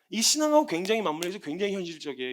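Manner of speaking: ordinary talk, nothing marked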